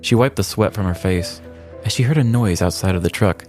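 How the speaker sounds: normal talking voice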